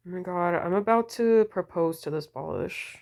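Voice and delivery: ordinary delivery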